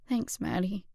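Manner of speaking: soft, teary thanks; sniffling. Acted. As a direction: thank her through sniffles. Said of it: "She sniffled".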